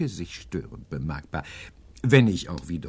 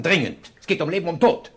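higher, louder urgent; 8 semitones higher, 7 dB louder than usual. Acted. Professor to Hedge about life and death, urgent. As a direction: press the urgency hard. Urgent phone call needed.

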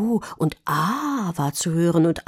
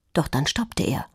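ordinary delivery

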